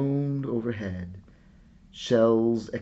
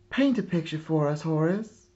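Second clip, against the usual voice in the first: quick, higher, excited begging; eager sisters' plea; 6 semitones higher, 4 dB louder than usual. Acted. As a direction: rush it like little sisters who can't wait. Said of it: "his sisters cried".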